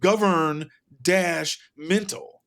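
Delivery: "govern", "dash", "mental" spoken as three slow, separated pieces; split-word emphasis. Shown as a demonstration. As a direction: draw out "govern dash mental" with a break either side of "dash". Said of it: "the way he always writes governmental".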